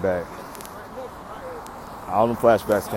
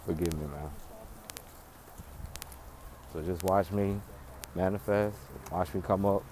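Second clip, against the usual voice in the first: quiet plea; 4 semitones lower, 14 dB quieter than usual. Real, not acted.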